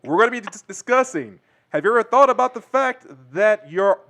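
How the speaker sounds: put-on slow booming old-timey radio host; grand old ballroom announcer. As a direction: welcome listeners like a vintage radio announcer. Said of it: "an old-timey radio announcer".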